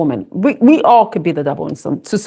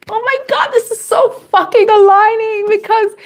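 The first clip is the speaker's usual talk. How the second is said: a much higher, thrilled exclamation; excited squeal; 11 semitones higher than usual. Real, not acted. Reader spotting Jack Nicholson in the card, a high excited squeal. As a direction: gasp it out high, delighted by the match.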